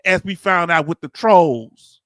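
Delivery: ordinary talk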